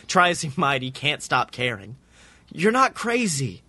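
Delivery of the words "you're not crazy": whiny pleading. A narrator's reading. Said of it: "whiny pleading in his voice".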